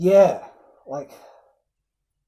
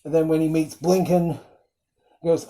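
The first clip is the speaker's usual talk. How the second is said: ordinary delivery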